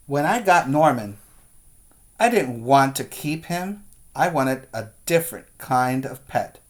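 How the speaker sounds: lively read-aloud storytelling voice; reading to children. A narrator's reading. Teacher reading a picture book to the class, animated. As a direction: read it like story time for little kids.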